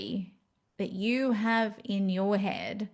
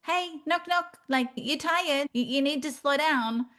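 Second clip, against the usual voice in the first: pestering; 6 semitones higher than usual. Retold or imitated. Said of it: "chucking some pebbles at you, going".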